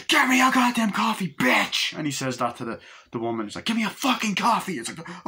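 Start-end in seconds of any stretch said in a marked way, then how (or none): 0.1-1.9 high, loud furious yell; mock thug screaming at the waitress; a thug bullying the waitress with a high, loud yell. 3.7-4.8 high mock-thug shout; a thug barking for coffee in a high shout; thug yelling. Thug menaces waitress.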